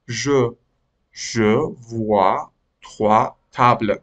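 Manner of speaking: "je vois trois tables" drawn out slowly; slow model reading. Shown as a demonstration. Teacher reads "je vois trois tables" slowly for the students. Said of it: "teacher models the whole sentence".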